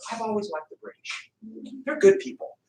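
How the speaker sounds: a hint of a timid congressman changing sides; mock turncoat. Fleeing congressmen voiced, switching sides.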